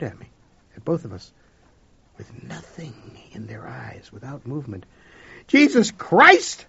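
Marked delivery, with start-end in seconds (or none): 5.5-6.6 slow, high furious yell; shout. Acted. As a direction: bellow it out in outrage, much louder. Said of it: "I shouted".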